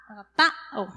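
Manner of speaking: "Agapao" broken up, PA stressed, then a separate o; accent on the A. Shown as a demonstration. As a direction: say agaPÁ-o, leaning on the A. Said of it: "con tilde en la A".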